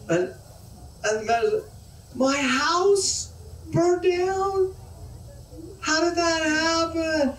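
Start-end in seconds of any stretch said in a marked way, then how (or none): 2.1-7.3 high, rattled man's voice; a man whose world is falling apart, squeaking up high; higher, frantic disbelieving man; alarmed man. Acted. The man learns house burned.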